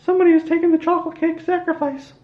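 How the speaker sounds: very high, loud squeaky cartoon mouse; villainous falsetto Mickey proclamation, loud and gleeful. Mickey announces raising Walt Disney, in a loud high squeaky voice. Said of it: "And Mickey said".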